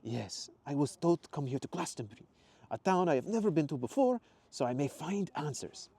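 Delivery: put-on measured Italian-accented explanation; collected Italian accent. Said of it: "took a breath and collected himself".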